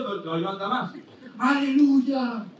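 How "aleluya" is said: slow, stretched hallelujah; drawn-out hallelujah. Retold or imitated. Rider cries praise to make the horse go faster, slow and stretched.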